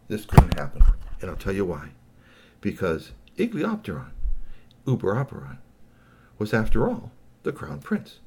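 ordinary delivery, nothing marked

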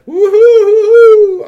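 high, drawn-out hooting; alien singing whoop. A narrator's reading. Star-Lord rises before the prince, joyful high whooping. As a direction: let out one long, loud alien whoop. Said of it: "a lot of singing going on".

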